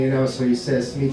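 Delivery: usual speaking voice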